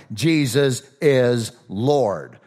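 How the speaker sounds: Jesus is Lord drawn out slowly, each word weighted; slow emphasis. Shown as a demonstration. Pastor stressing the creed's ending to his listeners, slow and weighty. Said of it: "Notice how it ends".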